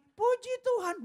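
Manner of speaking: very high parrot praise. Retold or imitated. Parrot praising as the visitor comes near, very high. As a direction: squawk it up high.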